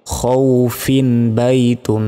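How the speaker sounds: খৌফিন বাইতুন read slowly and joined, no stop after খৌফিন; slow, linked recitation with no stretch. Shown as a demonstration. Teacher shows reading on without pausing, slow. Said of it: "যেমন".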